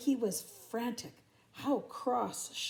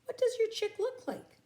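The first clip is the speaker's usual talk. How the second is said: kangaroo voice; 13 semitones higher than usual. Acted.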